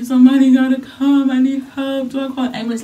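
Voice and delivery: mildly acted panicked call for help; panicked plea. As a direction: beg for help down the phone. Retold crying call to mother.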